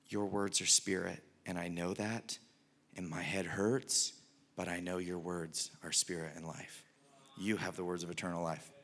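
hushed, low and earnest; humble disciple's surrender. Retold or imitated. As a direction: confess it softly, as the acted disciple. Imagined Peter submitting despite confusion.

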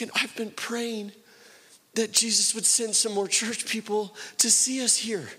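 acting out a high, choked-up confession; emotional retold plea. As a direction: play her long-held prayer, high and heartfelt. Retold woman's emotional confession of praying.

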